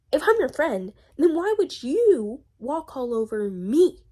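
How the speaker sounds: loud, high, indignant challenge. Real, not acted. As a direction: confront them, stretching out the question. Angrily confronting the absent friend.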